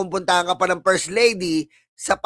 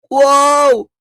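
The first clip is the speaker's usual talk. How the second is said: loud sarcastic wow, pitched up; 4 semitones higher, 7 dB louder than usual. Acted. Vlogger mocking the notification warning, loud sarcastic wow. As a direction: shout it up to mock being impressed.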